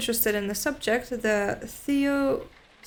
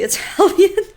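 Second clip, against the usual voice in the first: words broken by a real laugh; laughing while reading; 10 semitones higher, 10 dB louder than usual. Real, not acted. Reading out the Italian flourish, laughing through the words. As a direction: laugh right through the phrase.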